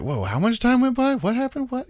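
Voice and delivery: high, amazed whoa at the years gone by; mock bewilderment. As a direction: play it up as a baffled older guy. Mock bewildered inner reaction.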